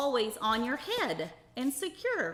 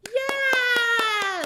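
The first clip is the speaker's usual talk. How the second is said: excited cheer; 14 semitones higher, 9 dB louder than usual. Acted. Cheering after the chant.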